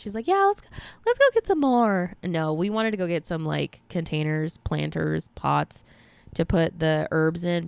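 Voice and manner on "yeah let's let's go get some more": eager mom. Retold or imitated. Mom eager to buy more plants.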